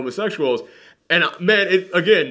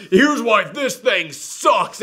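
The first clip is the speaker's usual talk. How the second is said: slow, higher, snarky clickbait voice; mock YouTuber; 7 semitones higher, much slower than usual. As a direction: sneer it like a clickbait title. Mocking YouTube video titles.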